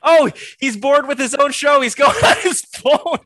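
high, loud call-out; loud teasing. Real, not acted. Teasing the host for going on his phone, loud mock accusation.

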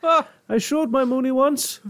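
very high comic Irish brogue; Irish Robin Williams. Acted. Riffing on the moony pun, high Irish accent. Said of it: "that just sounds like an Irish Robin Williams".

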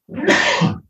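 a sharp real sneeze; sneezing. Real, not acted. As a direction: let a sudden sneeze out. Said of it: "I'm gonna sneeze".

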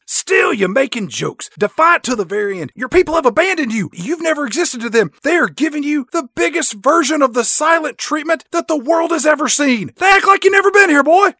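gloating henchman boss cranking it up to a yell; villain taunt. Acted. Papa Moon taunts captured Bond.

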